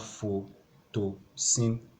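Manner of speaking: photosynthesis slowly split into five beats; syllable by syllable. Shown as a demonstration. Teacher counting beats for a class, syllable by syllable. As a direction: say photosynthesis one syllable at a time, with gaps. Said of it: "you have to beat back five times".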